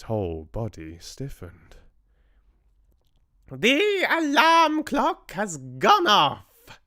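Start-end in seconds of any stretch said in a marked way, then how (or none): 3.5-6.8 high, loud, slow triumphant shriek; a witch delighting in her spell working; witch's shriek. A narrator's reading. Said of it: "shrieked the Grand High Witch".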